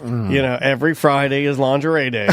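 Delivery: mock deadpan boss. Mock company boss announcing rules.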